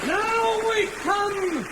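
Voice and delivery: high, long-drawn showman's call; grand announcement. Acted. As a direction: stretch it out high like a stage announcer. Showman announces the dramatic part.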